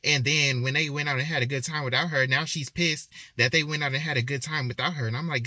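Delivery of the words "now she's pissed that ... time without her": usual speaking voice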